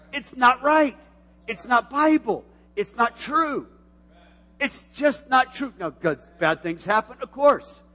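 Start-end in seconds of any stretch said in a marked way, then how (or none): none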